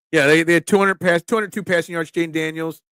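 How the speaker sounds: ordinary delivery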